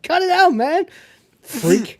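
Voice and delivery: mock big-brother yell; yelling at little brother. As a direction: shove him off with a yell. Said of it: "He's like".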